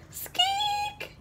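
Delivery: very high, soft mouse squeak; tiny high squeak. Acted.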